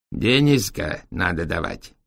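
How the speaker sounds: put-on slow broken Chinese-accented speech. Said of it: "с китайским акцентом". (с китайским акцентом)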